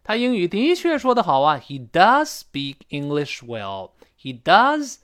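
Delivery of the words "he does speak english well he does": emphatic "does" before "speak"; stress on does. Shown as a demonstration. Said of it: "读得高".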